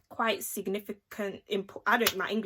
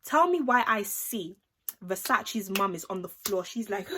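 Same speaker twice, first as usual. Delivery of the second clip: ordinary delivery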